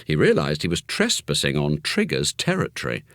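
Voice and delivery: usual speaking voice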